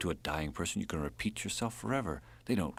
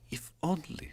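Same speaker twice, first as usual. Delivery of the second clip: Drenka, wistful; 10 semitones higher, 3 dB quieter than usual. Acted. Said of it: "said Drenka".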